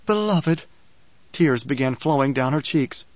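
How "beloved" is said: tearful. A narrator's reading. Chani, tears flowing.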